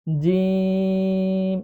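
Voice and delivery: জিম with the vowel held very long, three alif; long jim. Shown as a demonstration. Teacher showing students জিম with a very long drawn vowel. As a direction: stretch জিম slowly out. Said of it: "তিন আলিফ টান দিয়ে পড়তে হবে".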